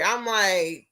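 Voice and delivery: normal talking voice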